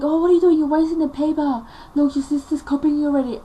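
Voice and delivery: high, loud imitation of mother's outburst; mock scolding mother. Mother catches daughter wasting paper, mock scolding.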